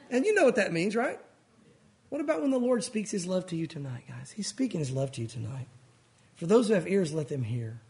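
ordinary delivery

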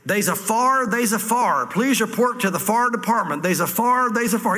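Southern drawl on they's a fire, a light put-on; southern accent. Dad on the phone calling the fire department, in a Southern accent. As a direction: mimic a country fire call in a Southern accent. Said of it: "Of course, in the southern accent".

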